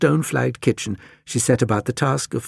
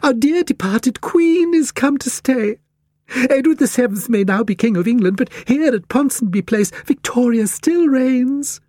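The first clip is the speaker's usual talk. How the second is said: excited Cat Lady; 13 semitones higher, 4 dB louder than usual. A narrator's reading. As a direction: announce grandly in a high, fluting lady's voice. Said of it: "cried the Cat Lady excitedly".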